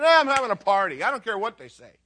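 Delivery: loud, brisk, acted brush-off; defiant host. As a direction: announce it boldly, not caring who objects. Acts Simon defying the authorities.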